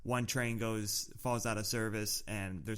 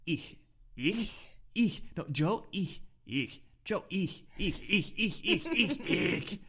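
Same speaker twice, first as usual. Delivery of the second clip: imitating a teacher drilling "ich", higher each time; nagging German teacher; 8 semitones higher, slower than usual. Teacher keeps correcting Joe mid-lesson, mock insistent drilling. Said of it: "she would keep saying".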